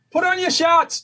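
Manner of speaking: very high, loud Boston holler; Boston accent. Acted. Mimicking everyday talk back home, high loud Boston accent. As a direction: yell it with Boston R's dropped, "shots" for shorts. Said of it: "be like".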